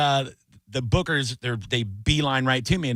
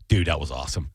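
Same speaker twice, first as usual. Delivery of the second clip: low dude voice; 10 semitones lower than usual. Retold or imitated. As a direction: lower laid-back sheriff voice. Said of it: "he goes".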